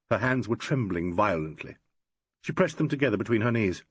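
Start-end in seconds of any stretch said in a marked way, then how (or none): none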